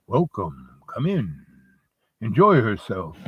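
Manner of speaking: low, subdued welcome with long pauses; Death as host. Acted. Death keeps greeting more guests.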